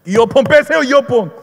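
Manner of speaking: loud, high repeated demand; imitated shouted order. Evangelist ordering a subway passenger, mock shouting. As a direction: bark it over their earphones, as retold.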